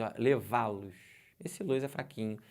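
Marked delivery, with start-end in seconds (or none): 0.2-0.9 levá-los with los barely voiced; weak unstressed los. Shown as a demonstration. Teacher demonstrating weak los to students. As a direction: mouth almost closed on the weak los. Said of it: "eu nem abro a boca quase".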